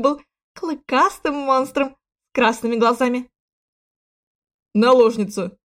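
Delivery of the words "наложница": loud, cocky retort; brash, smug answer; insolent. A narrator's reading. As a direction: toss it back brazenly, loud. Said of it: "нагло хмыкнул принц в ответ".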